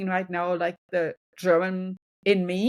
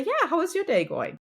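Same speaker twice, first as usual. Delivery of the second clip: friendly, higher, bright question to a cashier; cheerful demo; 9 semitones higher than usual. Shown as a demonstration. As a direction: chat up the cashier warmly.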